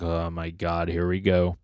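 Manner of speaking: low, quiet aside; resigned dread. Acted. Denji looks up at the newcomer, quietly resigned. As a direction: let it sink, soft and weary.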